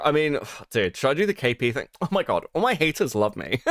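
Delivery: normal talking voice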